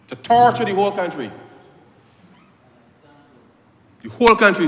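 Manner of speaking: a fierce shout of outrage at the opposition; impassioned outcry. Real, not acted. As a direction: thunder it at the whole House. Impassioned attack in parliament.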